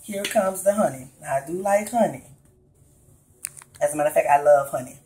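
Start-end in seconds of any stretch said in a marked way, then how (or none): none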